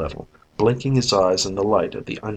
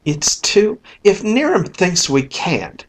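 flaring up; 9 semitones higher, 3 dB louder than usual. Acted. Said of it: "she flamed".

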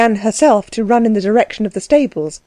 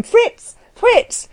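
much higher call across the yard. A narrator's reading. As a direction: call the coachman from afar. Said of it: "she began to call".

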